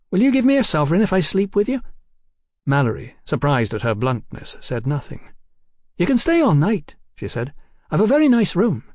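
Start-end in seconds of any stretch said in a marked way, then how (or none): 0.1-1.8 put-on high, quick and blunt girl's voice; fast, pitched-up cheeky London girl. A narrator's reading. Hetty propositions Mallory. 6.0-7.0 put-on much higher, lilting voice for a forward London girl; high-voiced cheeky London girl. A narrator's reading. Hetty invites Mallory. 7.9-8.8 put-on much higher, lilting voice for a forward London girl; high-voiced cheeky London girl. A narrator's reading. Hetty invites Mallory.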